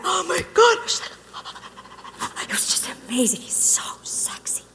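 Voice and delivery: breathless secret whisper; gossipy whisper. Retold or imitated. Gossiping with a friend about a dreamy guy, whispered.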